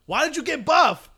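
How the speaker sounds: high, loud British-free incredulous outburst; incredulous scolding. Retold or imitated. Berating the film character over his muscles, incredulous. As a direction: demand an answer, pitched high and loud.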